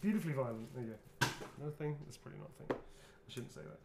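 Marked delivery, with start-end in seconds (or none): 2.0-3.8 whispered aside. Real, not acted. Muttered aside.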